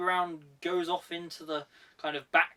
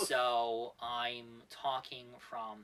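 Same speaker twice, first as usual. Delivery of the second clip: slow, quieter American accent; 6 semitones lower, 6 dB quieter, slower than usual. Shown as a demonstration. Demoing accents for co-host, slow American accent. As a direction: stretch out a mild American accent. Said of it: "the American accent comes from the".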